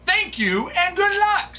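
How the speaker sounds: way up high, sing-song; high sing-song thanks. Real, not acted. Streamer thanking Jonathan for the break, very high sing-song. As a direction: chirp the thanks brightly.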